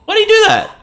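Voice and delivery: high loud shocked yell; shocked outcry. Real, not acted. Streamer shocked by a cutscene, crying out loud. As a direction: cry out in disbelief. Shocked reaction to game scene.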